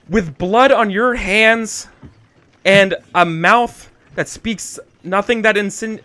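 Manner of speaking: theatrical courtroom denouncing; dramatic accusation. Acted. Dramatic accusation to rival noble.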